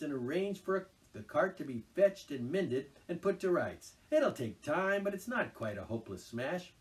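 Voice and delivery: businesslike Rat. A narrator's reading. Rat instructs Toad on what to do. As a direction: lay out the plan briskly.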